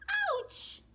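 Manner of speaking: very high, loud yelp; shrieking ouch of the cheetah; ouch cry. Acted. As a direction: yelp in pain. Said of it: "cries Little Cheetah".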